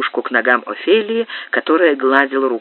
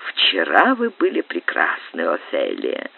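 loving whisper; 10 semitones lower, 6 dB quieter than usual. A narrator's reading. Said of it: "прошептал с любовью".

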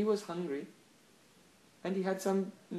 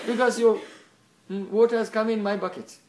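indignant scolding; 4 semitones higher, 8 dB louder than usual. Acted. Scolding goes on, acted.

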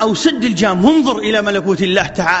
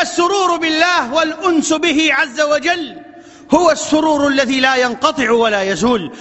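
ordinary talk

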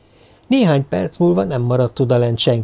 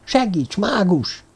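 high, muted plea for help; stifled cry; 8 semitones higher, slower than usual. A narrator's reading. Kígyó begging the mage for help, stifled cry. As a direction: plead urgently, holding the volume back. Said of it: "kiáltotta elfojtó hangon".

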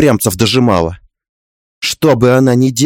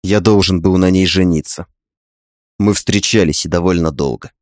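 low male voice; 5 semitones lower than usual. A narrator's reading. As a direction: voice the husband low and tender. Husband voiced in story.

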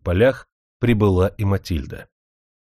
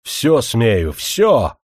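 furious roar; 4 dB louder, much slower than usual. A narrator's reading. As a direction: bellow it in rage. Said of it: "в гневе заорал он".

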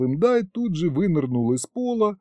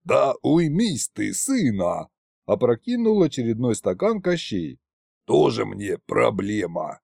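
put-on tipsy old tsar Koschei; 3 semitones lower than usual. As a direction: brush it off like a drunk old king. Koschei voiced over many lines.

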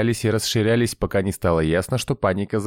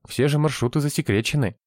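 put-on higher-pitched, puzzled girl's voice; surprised; 7 semitones higher than usual. A narrator's reading. Operator explains to the two friends, surprised. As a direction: point it out in a high, surprised voice. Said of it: "удивилась девушка".

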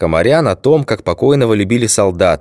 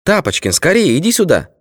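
high-pitched calling from the kitchen; 10 semitones higher than usual. A narrator's reading. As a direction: shout for him across the flat. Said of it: "раздался крик Кати".

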